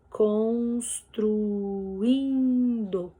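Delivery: "Construindo" extremely slow, every syllable held; very drawn-out "Construindo", U and I held apart. Shown as a demonstration. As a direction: stretch "Construindo" into four long beats, cons-tru-in-do. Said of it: "separada com quatro sílabas".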